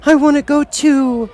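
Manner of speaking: put-on a girl left behind calling; mild scream. A narrator's reading. Dorothy cries after the rising balloon, high-pitched. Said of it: "she screamed".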